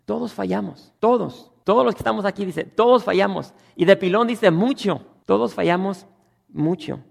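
full-force preaching. Acted. Pastor drives the point at the congregation, loud. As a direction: hammer home that everyone fails.

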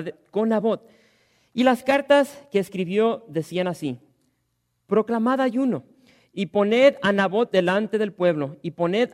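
loud, ringing Bible reading; scripture reading. Acted. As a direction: proclaim the text to the congregation. Reads scripture aloud.

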